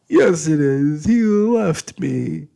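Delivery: high, loud tearful insistence, slowing down; sobbing. Acted. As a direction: cry it out, stubborn and hurt. Sobbing girl insists to uncle.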